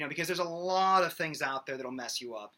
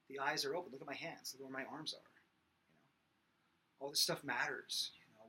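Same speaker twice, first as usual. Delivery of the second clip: normal talking voice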